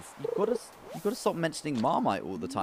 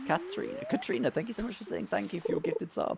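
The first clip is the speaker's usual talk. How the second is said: excited thanks; 10 semitones higher than usual. Real, not acted. Thanking a subscriber.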